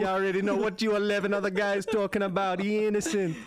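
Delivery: lightly put-on Jamaican accent juror; a hint of Jamaican, insisting. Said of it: "Jamaican twelve Angry Men".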